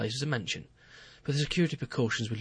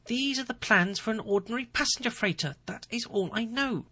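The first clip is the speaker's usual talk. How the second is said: much higher, wide-eyed denial; innocent-acting president; 11 semitones higher than usual. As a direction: play the baffled innocent. Ferrero denies again.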